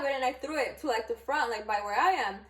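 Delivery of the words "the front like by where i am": usual speaking voice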